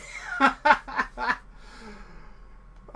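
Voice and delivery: laugh. Real, not acted. Reacting to a teammate.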